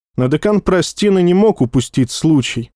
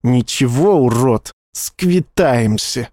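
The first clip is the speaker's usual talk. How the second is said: impotent hiss; slower than usual. A narrator's reading. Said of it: "бессильное шипение".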